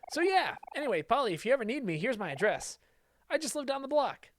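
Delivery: put-on high, chirpy neighbour kid; friendly boy voice. Kel offering his address to Polly, cheery and high.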